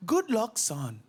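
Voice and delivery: high, then slow drawn-out mum voice; comic son imitating his mum wishing him luck. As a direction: play the doting mum getting the phrase wrong. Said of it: "She's like".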